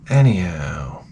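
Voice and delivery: low whisper, trailing off; whispered aside. Real, not acted. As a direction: breathe it out under your breath.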